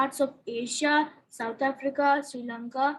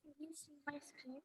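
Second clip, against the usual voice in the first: very faint, far from the mic; barely audible; 25 dB quieter than usual. Real, not acted. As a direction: voice almost lost, very soft. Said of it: "your voice is very low".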